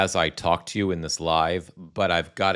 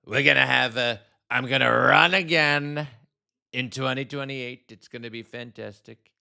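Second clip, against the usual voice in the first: a gleeful Trump brag, pitched way up; mocking Trump impression; 7 semitones higher than usual. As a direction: parody Trump hyping his own joke. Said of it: "there will be some Trump impersonations".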